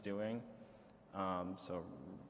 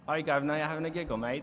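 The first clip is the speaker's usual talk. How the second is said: hammed-up British accent, loud and higher; 7 semitones higher, 10 dB louder, faster than usual. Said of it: "you do the British, like, stereotype British stuff".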